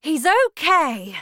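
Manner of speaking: loud, high, relieved cheer; delighted cry. A narrator's reading. Wonka celebrating the boy's safety, crying out. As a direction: announce it in triumph.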